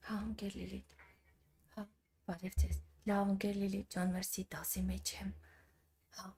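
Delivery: hushed whisper into the phone, trying not to disturb. Real, not acted. Streamer answering a phone call mid-lesson, whispering. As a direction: whisper quickly, she's in class.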